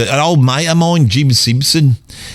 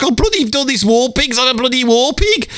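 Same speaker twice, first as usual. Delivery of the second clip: lightly voiced mate teasing about war pigs; 10 semitones higher than usual. [name] ribbing the band, high mock voice. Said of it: "And [name] is like".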